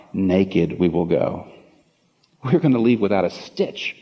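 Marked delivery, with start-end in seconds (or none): 0.1-1.5 slow, lower, hushed reflection; solemn hush. Real, not acted. Solemn echo of Job.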